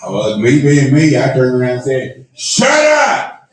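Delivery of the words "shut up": very high shouted comeback; sudden high-pitched mock-furious shout; mad retort. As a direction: re-enact an angry yell back. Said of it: "Well, I was mad".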